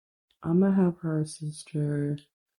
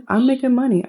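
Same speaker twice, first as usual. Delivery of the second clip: excited brag; 8 semitones higher, 5 dB louder, faster than usual. Acted. Voicing a boastful Sims character.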